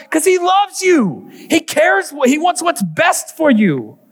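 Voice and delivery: high, slow, fervent cry; impassioned preaching. Real, not acted. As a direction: preach it out with all your heart. Preacher climaxes on God's love.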